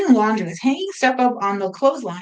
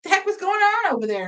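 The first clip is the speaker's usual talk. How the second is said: neighbor; 10 semitones higher than usual. Retold or imitated. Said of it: "he's like".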